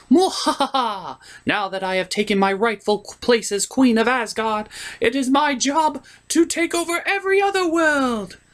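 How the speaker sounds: high gloating villain voice; sneering panto villain. Acted. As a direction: ham up the evil queen. Hela gloats as queen.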